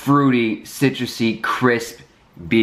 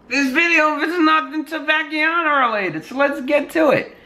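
high silly voice. Acted. Mock exasperated aside to viewers.

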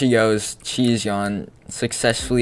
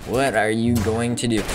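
ordinary talk, nothing marked

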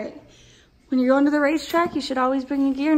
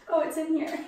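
ordinary delivery

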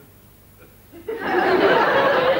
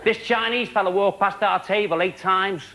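ordinary delivery

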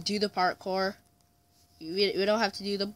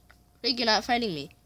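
usual speaking voice

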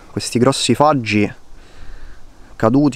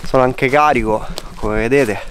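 ordinary talk

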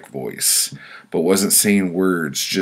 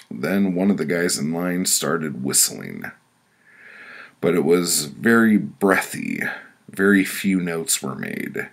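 normal talking voice

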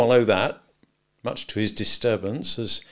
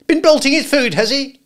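high, loud, scolding outburst; sharp cry; 11 dB louder than usual. A narrator's reading. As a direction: cry it out accusingly. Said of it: "cried my sister".

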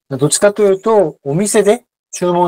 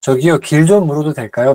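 fast Korean; much faster than usual. Shown as a demonstration. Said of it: "teacher models fast Korean".

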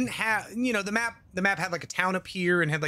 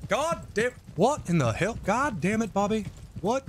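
King of the Hill dad; 3 semitones higher than usual. Acted. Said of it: "The dude from King of the Hill".

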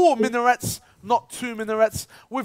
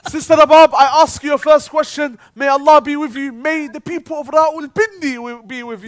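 grandiose host; 4 semitones higher, 7 dB louder than usual. Acted. Grand quiz host address.

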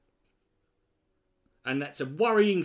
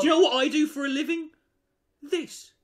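indignant retort; 9 semitones higher, 4 dB louder than usual. Real, not acted. Outraged retort.

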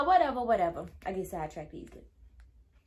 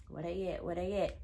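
soft, lower self-talk while hunting around; searching mutter; 5 semitones lower, 7 dB quieter than usual. Retold or imitated. Retold searching, talking to herself.

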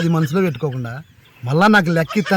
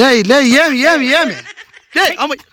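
high alarmed yelps; 7 semitones higher than usual. Acted. Scuffle, uncle yelps.

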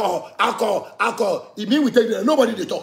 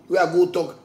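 ordinary delivery

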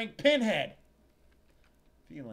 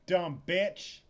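angry muttered insult; 4 semitones higher, 10 dB louder than usual. Acted. Insult spat after threat.

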